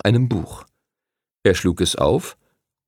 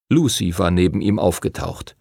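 ordinary delivery

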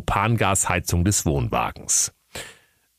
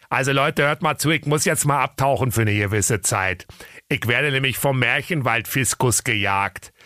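put-on Berlin accent; 6 semitones higher than usual. A narrator's reading. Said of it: "Und der Berliner Bär sprach".

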